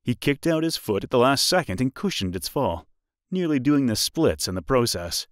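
plain reading voice

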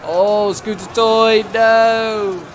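high, loud, stretched-out groan of dismay; real dismay as the unit falls; dismayed cry. Real, not acted. His unit is wiped out.

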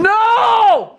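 sudden loud shout. Acted. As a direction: bellow it out. Santa role outburst.

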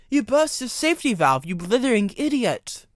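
high, loud telling-off; exasperated cry. A narrator's reading. Driver berating Gordon for the burst valve, high loud cry. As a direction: yell it up high at the foolish engine. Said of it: "cried Charlie".

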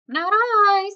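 high, loud little mouse voice; storybook mouse. A narrator's reading. Mouse refuses to make the cake, high loud character voice.

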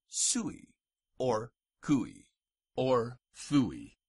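sui, cui, fui with "oo-ee"; sui, cui, fui set apart, each ending in oo-ee. Shown as a demonstration. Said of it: "pronounced ui".

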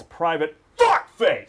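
"private" played down, weight thrown on "fuck face". Shown as a demonstration. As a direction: keep "private" unstressed, punch "fuck face". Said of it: "the private's not that pronounced".

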